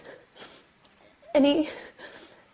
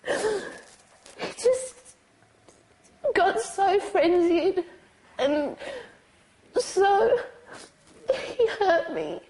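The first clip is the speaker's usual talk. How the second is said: tearful; 6 semitones higher, slower than usual. Real, not acted. Survivor still crying mid-account.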